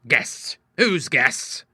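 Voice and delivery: indignant, rising challenge; angry. A narrator's reading. Father challenges daughters about the guests, angrily. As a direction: throw the question back crossly. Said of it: "he cried angrily".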